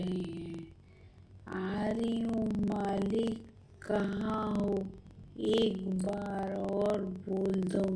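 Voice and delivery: slow, soft, eerie witch drawing near. Acted. Summoned witch slowly calling out to her master. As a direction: creep in slowly like a summoned spirit.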